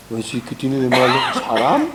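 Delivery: chuckling, brushing off the objection; laughing dismissive reply. Retold or imitated. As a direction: laugh through a careless shrug of an answer. Duke's careless reply, laughing.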